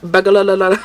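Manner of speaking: loud mock Scottish, syllables tumbling over each other; bad Scottish accent. Streamer imitating Scottish woman stuck on burglar, badly faked Scottish accent. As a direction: garble it in a hammed-up Scottish accent. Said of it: "I can't do the Scottish accent very well".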